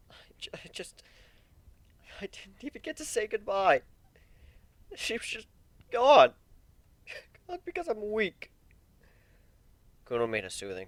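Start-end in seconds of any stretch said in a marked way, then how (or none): 0.1-8.5 high, breaking voice rising to a cry on gone; tearful breakdown. Acted. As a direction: let the grief crack through. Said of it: "fresh tears spilling down his cheeks".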